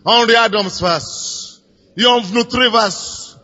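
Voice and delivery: normal talking voice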